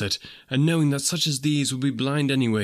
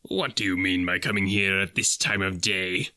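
feigned gruffness; 4 semitones lower than usual. Acted. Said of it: "in his accustomed voice, as near he could feign it".

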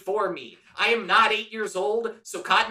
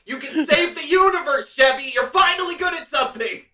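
higher, louder sarcastic cheer; mock praise; 5 semitones higher, 5 dB louder than usual. Crew mocks Chevy for finally being useful, sarcastic cheer.

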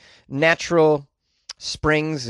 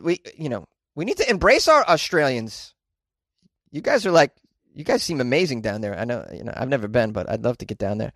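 ordinary delivery

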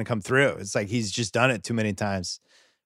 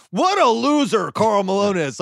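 mock Walton; 7 semitones higher, 4 dB louder than usual. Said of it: "Bill Walton".

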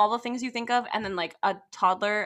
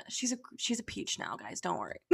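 ordinary talk

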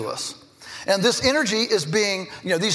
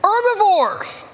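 high, slow, loud herbivores; drawn-out correction; 12 semitones higher, 5 dB louder, slower than usual. Retold or imitated. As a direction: stretch it out to correct them. Teacher correcting kids.

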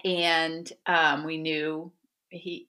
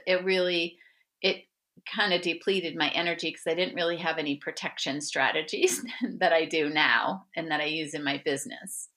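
usual speaking voice

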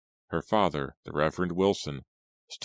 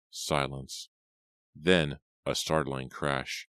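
ordinary delivery

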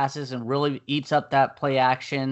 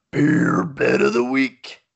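announcer voice; 5 dB louder, slower than usual. Acted. Announcing the weekly beer bet.

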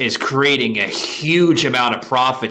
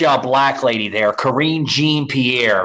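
normal talking voice

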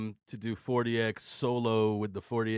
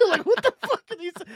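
laughing; 6 dB louder, faster than usual. Retold or imitated. Laughing imitation of Nikki.

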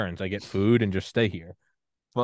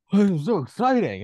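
high, bright mock gushing, louder; gushing excitement; 10 semitones higher, 5 dB louder than usual. Excited Southerner gushing about a snowstorm, mock thrilled. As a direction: gush like a kid seeing snow. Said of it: "I'm sitting here like".

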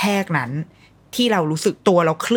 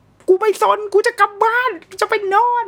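put-on high, childish demand; whiny toddler voice; bratty high baby whine; voicing the baby; 4 dB louder than usual. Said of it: "คือมันแบบ".

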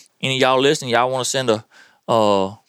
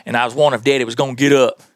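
normal talking voice

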